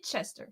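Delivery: Hitchester, a quiet "hit-chester"; coached pronunciation. Shown as a demonstration. Relaying a viewer's tip, quiet pronunciation demo. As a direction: softly sound out Hitchester from "hit" plus "chester". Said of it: "He said, say it like".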